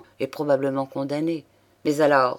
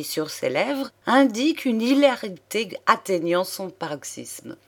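plain reading voice

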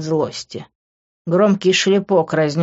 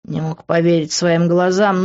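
ordinary delivery

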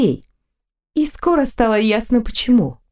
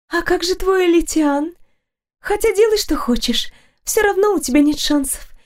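sad; 9 semitones higher than usual. A narrator's reading. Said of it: "печально покачала головой другая девушка".

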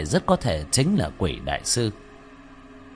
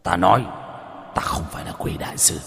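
deep voice; 3 semitones lower, faster than usual. A narrator's reading. Middle-aged man denies it after being hit, low voice. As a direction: sink into a deep, hushed denial. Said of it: "trầm giọng".

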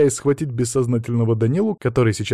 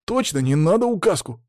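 put-on higher-pitched eager boy's agreement; agreeing boy; 7 semitones higher than usual. A narrator's reading. Pupil seconds his friend's plea, eagerly agreeing. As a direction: back up your pal, pitched up. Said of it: "закивал Боря".

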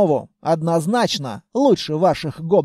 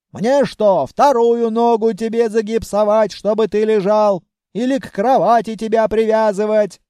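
raised-voice grandmotherly telling-off; angry scolding; 4 dB louder than usual. Acted. Grandmother berates her patient for leaving bed, angry scolding. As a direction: scold a disobedient patient hard.

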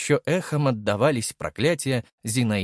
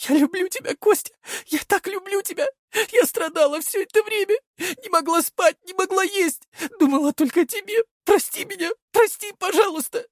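high voice choking on tears; sobbing high plea; 3 dB louder than usual. A narrator's reading. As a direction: beg through sobs, high and breathless. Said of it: "Она шептала, захлёбываясь". (захлёбываясь от слёз)

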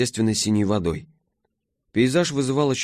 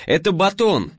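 высоко и громко, оживлённо; кричи поверх шума двигателя; перекрикивая мотор; 10 semitones higher, 4 dB louder than usual. A narrator's reading. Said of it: "перекрикивая вой мотора, оживлённо объясняла". (кричит сквозь вой мотора)

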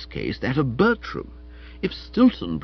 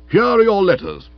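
loud, raised, formal stiffness; stiff; 10 semitones higher, 8 dB louder than usual. A narrator's reading. Stilton handing letters to Florence, stiffly and loudly. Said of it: "he said stiffly".

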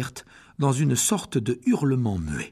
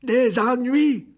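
high, slow, loud creature question; old spider, worried; 10 semitones higher, 5 dB louder, much slower than usual. Acted. Old spider Aragog reacts to Hagrid's trouble, slow and high. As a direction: draw it out with alarm, spider-like. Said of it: "dit la vieille araignée".

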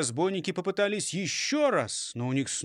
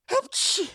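very high stage sneeze; acted sneeze; 4 dB louder than usual. A narrator's reading. Robber sneezes amid the lament, very high acted sneeze. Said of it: "чихнув".

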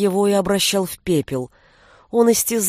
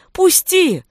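much higher, louder cry; louder desperate cry; 9 semitones higher, 4 dB louder than usual. A narrator's reading. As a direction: scream it even harder. Beating on door, pleading.

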